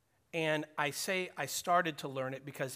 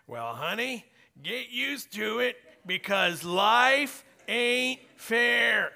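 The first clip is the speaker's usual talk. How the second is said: put-on higher, louder and slow, talking down to a child; blunt parent retort; 7 semitones higher, 9 dB louder, slower than usual. Parent tells the girls in the car, slowly and loudly. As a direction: lay down the hard truth to the kid. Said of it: "the words are on my lips".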